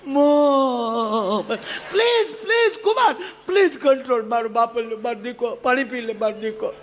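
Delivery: high, hammed-up crying plea; mock sobbing son-in-law. Son-in-law cries to mother-in-law, hammed-up sobbing. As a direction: blubber like an upset son-in-law.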